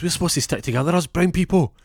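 high, loud protesting plea; indignant appeal. Acted. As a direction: plead for solidarity, pitched right up. Accused man appeals to detective's solidarity.

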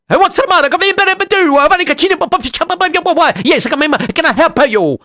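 high, hammed-up Italian accent; funny Italian accent. Local answers the visiting rabbi, comic Italian accent. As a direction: babble in a cartoon Italian accent. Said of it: "you could stop talking funny".

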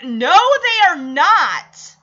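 loud protest. Retold or imitated. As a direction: say it out loud at the screen. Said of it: "I said right out loud".

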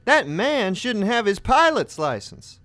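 high, slow, indignant declaration. Retold or imitated. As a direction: proclaim it to the cabin. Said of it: "I'm seething".

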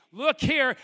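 loud, high mock yell of the wife; imitating a yell. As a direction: shout it across the yard like the wife. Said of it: "my wife is yelling at me".

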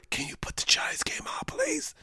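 embarrassed whisper to the bartender. Retold or imitated. Ashamed fan asking the bartender for the game, whispered. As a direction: whisper it so nobody hears you're a Giants fan. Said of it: "You gotta whisper".

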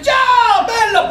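very high exuberant call-out; mock Italian greeting. Oblivious orderly hailing people, high shouted mock greeting. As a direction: sing out a hammed-up hello.

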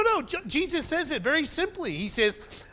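usual speaking voice

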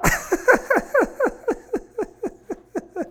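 helpless high giggling fit; laughing so hard the voice goes up; real high-pitched laughter. Real, not acted. Host cracking up over the hopping employee, high laughter.

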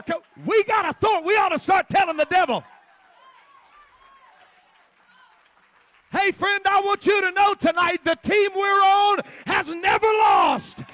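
preaching at full force. Acted. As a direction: hammer home the victory.

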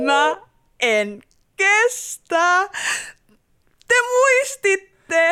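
high, slow, gushing delight; overwhelmed delight. Acted. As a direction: gush that they remembered. Moved by surprise party.

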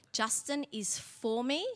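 soft, slow inner voice; telling herself calmly in her head; slow quiet self-talk; inner thought. Real, not acted. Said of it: "in my head".

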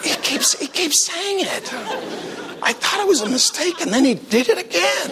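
high, soft old-lady put-on voice; scandalized grandmas. Grandmas reacting to the repeated teaching, scandalized. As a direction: play a dismayed little grandma. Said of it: "all the grandmas are like".

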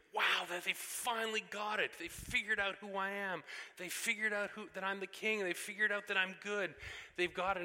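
high, loud, delighted imagined voice of Jesus; imagined relieved joy. Retold or imitated. Preacher voicing Jesus' imagined relief, high and loud. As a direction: beam with relief that they finally get it. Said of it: "we would expect that he would say".

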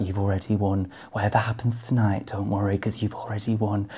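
lightly voiced Davina, hushed and kind; a hint of Davina comforting a nervous queen; Davina impression. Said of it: "But you do it so well".

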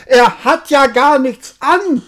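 high, loud crowd shout; whole crowd shouting. Acted. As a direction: shout it out together. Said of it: "rief endlich das ganze Volk".

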